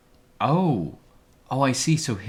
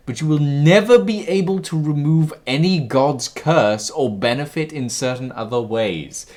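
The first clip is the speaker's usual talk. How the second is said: dramatic loud reading; 4 semitones higher, 10 dB louder than usual. Acted. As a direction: declaim it like a prophet. Reads dramatic game option.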